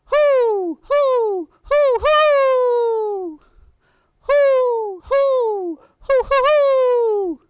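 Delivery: high, loud owl hoots, each one falling away; Pa calls out to the owl, hooting like a great horned owl. A narrator's reading. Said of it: "And Pa called".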